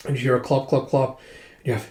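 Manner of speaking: ordinary delivery, nothing marked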